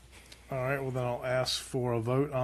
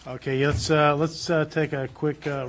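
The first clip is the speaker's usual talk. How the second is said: louder, raised voice to call a break over the chatter; calling out to the room; 2 semitones higher, 9 dB louder than usual. Real, not acted. Chairman calling the board to a ten-minute recess, loud. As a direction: announce the recess so the whole room hears.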